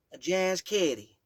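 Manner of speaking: put-on slow, loud, high insistent "A jazz kitty," in a Southern Kentucky accent; firm Southern Kentucky. Great-grandmother insisting to the child, firm Southern Kentucky accent. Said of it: "she said again".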